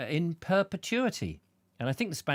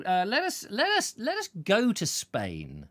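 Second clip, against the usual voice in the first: ordinary talk, nothing marked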